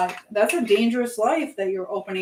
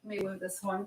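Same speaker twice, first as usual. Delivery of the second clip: ordinary talk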